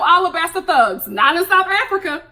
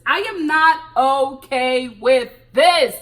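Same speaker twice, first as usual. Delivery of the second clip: very slow, each word spaced and stressed; emphatic refusal; 5 dB louder, much slower than usual. Real, not acted. Vlogger refusing Tiffany's choice, slow and emphatic. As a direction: lay down every word firmly. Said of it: "No, I'm not okay with this".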